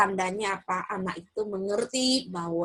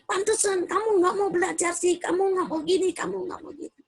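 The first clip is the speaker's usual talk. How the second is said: higher, scolding parent voice, lightly acted; judging parent; 8 semitones higher than usual. Parent judging a child who won't study, mock scolding. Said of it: "kita hakimi".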